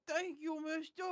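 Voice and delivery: very high, squeaky little voice; gasp, then pipe up high in thanks; delighted childlike character. Acted. C C thanks the gift-giver, high delighted squeak.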